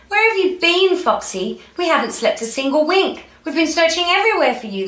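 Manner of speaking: high, fretful storybook relatives; worried family. Acted. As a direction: pour out acted worry, high-pitched and hurrying at the end. Worried family scolds returning fox.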